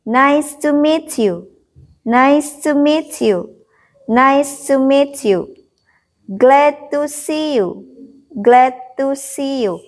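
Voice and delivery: slow, deliberate teacher's drill; model pronunciation. Shown as a demonstration. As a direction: say each phrase slowly for learners to copy. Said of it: "Guru mengucapkan sebanyak tiga kali".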